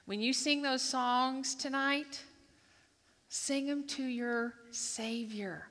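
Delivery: slow, soft and loving; tender exhortation. Acted. To the congregation before tonight's songs, slow and tender. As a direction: sing it to your Savior, gently.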